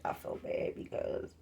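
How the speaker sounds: whispering I feel bad, hushed and unvoiced; a real hushed confession of guilt; whispered. Real, not acted. Repeats the line, whispered.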